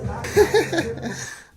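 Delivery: high, loud burst of laughter; laughing. Real, not acted. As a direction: crack up after singing along. Laughs after singing along.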